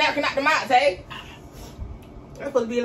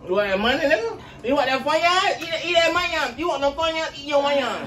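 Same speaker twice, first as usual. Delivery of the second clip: higher, louder mocking taunt; 7 semitones higher, 4 dB louder than usual. Real, not acted. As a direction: jeer at him, laughing. Taunting friend to eat wings.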